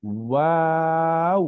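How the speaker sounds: ওয়াও stretched very slowly with a rise; teacher's repeat of the letter; drawn-out Waw. Shown as a demonstration. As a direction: say ওয়াও long with round lips. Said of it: "যদি আমি আবার বলি".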